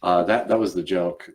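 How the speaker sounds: ordinary talk, nothing marked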